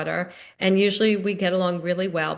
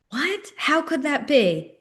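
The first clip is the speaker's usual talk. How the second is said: much higher, slow incredulous "What?"; mock disbelief; 7 semitones higher, slower than usual. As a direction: play astonished to make the point.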